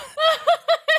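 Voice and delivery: sudden high-pitched laugh; high squeaky laugh. Real, not acted. Man laughing at his own dating cluelessness, with a high squeak.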